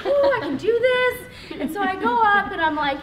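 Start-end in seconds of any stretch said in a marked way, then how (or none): 0.0-1.6 high, drawn-out nervous squeal; nervous resolve. Acted. Psyching herself up to approach Tara, nervously. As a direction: stretch it out high and loud, hammed-up nerves.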